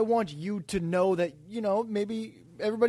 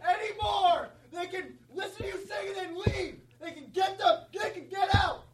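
laughing high rant; 9 semitones higher than usual. Acted. Mocking rant at listeners.

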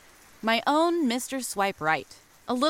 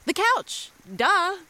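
excited realization; 9 semitones higher, slower than usual. Acted. Realizes where the phone is.

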